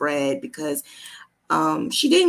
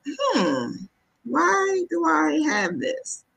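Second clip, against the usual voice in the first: higher, slow puzzled musing; 11 semitones higher, much slower than usual. Retold or imitated. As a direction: wonder aloud, a little thrown. Retold puzzled thought finding lump.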